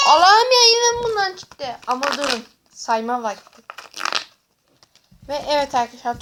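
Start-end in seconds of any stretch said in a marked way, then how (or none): 0.1-1.8 exasperated outburst. Real, not acted. Another duplicate card.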